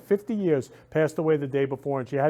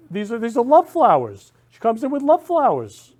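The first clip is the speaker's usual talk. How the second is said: excited; 7 semitones higher, 5 dB louder than usual. Real, not acted. Thrilled showing the gift.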